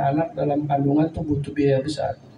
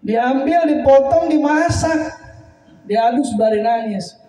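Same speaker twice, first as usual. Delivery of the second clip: much higher and louder, each word rising; emotional outburst; 10 semitones higher, 4 dB louder than usual. Real, not acted. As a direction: cry the steps out in anguish. Preacher re-tells with emotion. (berseru tinggi dan keras penuh haru)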